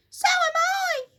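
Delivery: very high, loud shriek; shrill panic cry; terrified shriek. Acted. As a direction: Missus Twit shrieks in panic. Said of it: "cried Missus Twit".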